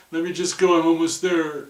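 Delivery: high, loud pleading climber; begging to keep climbing. Retold or imitated.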